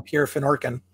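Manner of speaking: "Fennorkin" with a clear -nor- in the middle; Canadian pronunciation. Shown as a demonstration. Canadian guest corrects the host: "Fennorkin" with -nor-. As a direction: give "Pierre Fennorkin" the -or- sound, the Canadian way. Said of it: "up in Canada, we call him".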